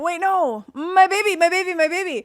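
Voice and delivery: high, loud imitation of a shriek; mock panicked mother. Friend teasing by imitating the mother, loud and high.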